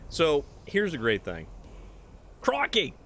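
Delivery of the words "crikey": mock Cockney exclamation. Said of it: "Shady Cockney accent there".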